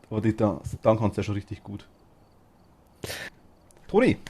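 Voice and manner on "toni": calling out. Real, not acted. Calling out to Toni.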